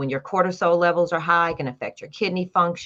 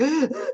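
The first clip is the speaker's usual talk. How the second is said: sharp gasp of sudden panic; high startled gasp; panicked gasp; 11 semitones higher than usual. Retold or imitated. Retelling panic attacks.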